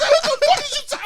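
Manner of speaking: high, loud shout of disbelief; hammed-up outraged shriek; retold freak-out. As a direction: yell it high, half laughing. Retold freak-out after tackle.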